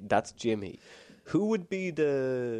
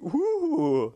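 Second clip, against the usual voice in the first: high, loud mock whoop; mock weird whoop; 5 dB louder than usual. Imitating the creepy noise Jimmy Savile made. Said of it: "he'd make this weird noise".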